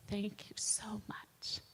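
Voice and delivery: whispered, slow, through tears; a stranger's whispered, tearful thanks. Retold or imitated. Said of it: "she began to cry and just said".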